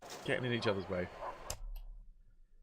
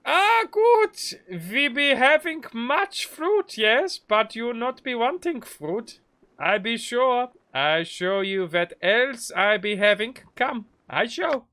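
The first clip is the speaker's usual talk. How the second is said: high, loud, slow hammed-up German merchant; exaggerated German accent, slow and loud; 10 semitones higher, 5 dB louder, slower than usual. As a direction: boom like a jolly German trader. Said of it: "this is the good accent".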